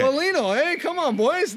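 high, loud fired-up hype cry; rally the boys like a pumped-up captain; passion captain. Retold or imitated. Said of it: "The passion captain".

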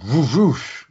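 high-pitched "whoosh" sound effect; imitated whoosh. Retelling Ed's escape from the show, imitated whoosh. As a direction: make a leaping, high whooshing noise. Imitates something zooming in action scene.

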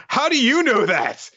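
high-pitched mock shock; hammed-up startled yelp of a question; flustered. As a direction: blurt it out high, as if found out. Flustered character quote in recap.

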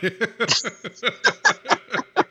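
high-pitched run of laughter; real laughter. Real, not acted. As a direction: laugh on at your own joke. Laughing at own joke.

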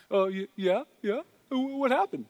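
high-pitched, eager talking dog; dubbed dog voice. Acted. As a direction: play a hopeful dog, high and halting. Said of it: "He's just doing the voice".

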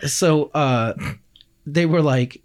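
usual speaking voice

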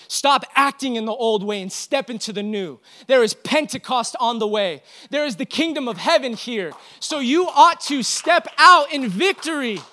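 loud, high-pitched preaching to the church; rally the congregation with fire; full-force preaching. Acted.

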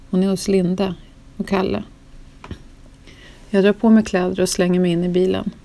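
ordinary delivery, nothing marked

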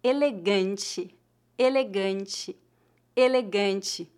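"elegante" said slowly, with a nasal "an" and the "te" as "tchi"; slow model word. Shown as a demonstration. Teacher to students learning Portuguese, slowly sounding out "elegante". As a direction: drill "elegante" three times, carefully. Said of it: "Como se pronuncia a palavra elegant em português?".

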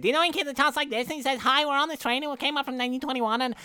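put-on high-pitched, fast-talking pest of a kid; annoying kid impression. Showing co-host how the annoying train kid talks, high kid impression. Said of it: "that is the best impression you have ever done".

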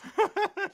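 sudden high-pitched burst of laughter. Real, not acted. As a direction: break into a high cackle.

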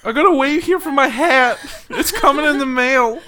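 high, laughing mock earnest voice; mock earnest man. Joking as the hat man waiting for mail, high and earnest.

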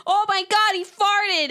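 high, loud mocking squeal; mock loud moviegoer. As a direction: ham up the rowdy guy in the theater. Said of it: "he's like".

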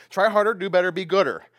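loud, punchy self-help slogan; mock pep talk. Preacher mocking man's way as a pushy motivator. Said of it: "Man's way sounds like this".

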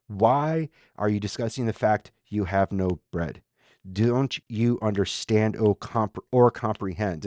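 measured, deliberate questions, each phrase set apart; staccato questioning. Acted. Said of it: "staccato questioning".

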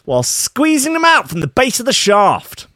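high, strained outburst; hammed-up alarm over a news article; mock-horrified reading. Reads gruesome article with mock horror.

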